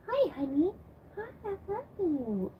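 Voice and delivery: high, soft cooing; affectionate pet talk. Real, not acted. Owner greeting her honey, affectionate high coo. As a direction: coo sweetly to a beloved pet.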